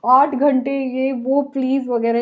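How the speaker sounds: slow, bewildered with a laugh; mock-shocked children drawing out each word; stunned kids. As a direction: gape at mum in disbelief. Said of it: "वो लोग ऐसे हो जाते हैं".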